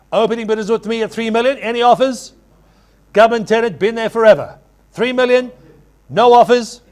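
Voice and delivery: loud urgent auction calling; frantic trading floor shouting. Real, not acted. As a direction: push the room for a bid. Auctioneer pushing for bids.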